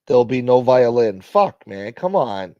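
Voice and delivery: ordinary talk, nothing marked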